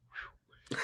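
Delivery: breathy exhaled phew of relief; relieved phew. Retold or imitated. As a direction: blow out a soft sigh of relief. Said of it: "And I'm like".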